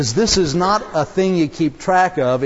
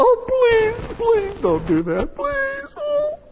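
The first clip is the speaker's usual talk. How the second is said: high, tearful pleading that cracks; begging; slower than usual. Retold or imitated. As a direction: beg for mercy, voice breaking high. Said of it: "And the man begged him".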